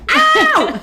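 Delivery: very high, loud drawn-out "ow"; mock pain scream. Woman showing what she held back to seem cool, comic scream. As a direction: let out a hammed-up scalded howl. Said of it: "instead of going".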